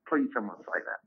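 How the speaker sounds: soft, hushed plea; quiet longing. Retold or imitated. Comic confessing his secret wish, softly. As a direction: murmur the wish.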